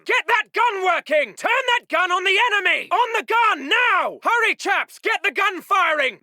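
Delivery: high, urgent commands; shouted battle order. Acted. Officer turning the gun on the enemy, shouting. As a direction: shout to get the gun manned.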